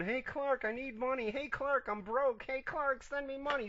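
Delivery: high, nagging mock Boone; whiny Boone impression. Boone nagging Clark for money, high whiny voice. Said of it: "This is Boone".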